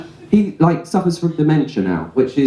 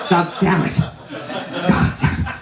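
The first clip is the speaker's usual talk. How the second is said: mock defeat; 6 semitones higher, 3 dB quieter, much slower than usual. Mock frustration, fading.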